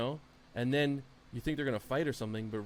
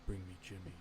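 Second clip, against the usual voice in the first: imitated low, very soft command; hushed, calm mimicry of a boss; Roman Reigns under breath; 5 semitones lower, 10 dB quieter than usual. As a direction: say it calmly under your breath, pitched down. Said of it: "talking under his breath".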